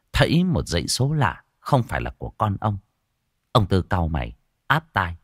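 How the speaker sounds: usual speaking voice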